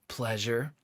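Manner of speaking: low one-word answer from the man; character's dialogue. A narrator's reading. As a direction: drop the pitch for his reply. Reading the man's answer aloud.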